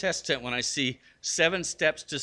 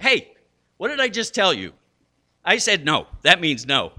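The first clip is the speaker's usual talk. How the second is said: loud, higher-pitched mock telling-off; scolding dad; 5 semitones higher, 9 dB louder than usual. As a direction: lay down the law to a child, loudly. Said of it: "I would have been like".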